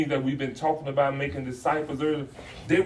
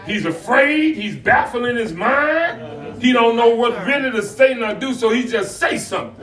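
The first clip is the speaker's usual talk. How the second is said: raised, rhythmic sermon phrases; animated preaching; 9 semitones higher, 11 dB louder than usual. Acted. Pastor explaining Peter's fear to the church, preaching. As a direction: build the list like a preacher.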